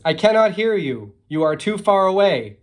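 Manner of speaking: loud, high-pitched yell back; shouting across a distance. A narrator's reading. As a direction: holler each word so it carries. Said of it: "they have to shout now".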